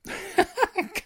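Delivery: high bursting cackle; cackling laugh. Acted. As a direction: slap your thigh and cackle. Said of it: "gackerte ich schenkelklopfend".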